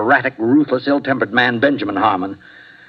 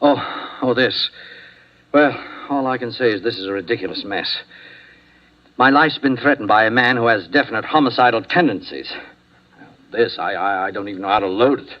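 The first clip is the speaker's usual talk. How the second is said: ordinary delivery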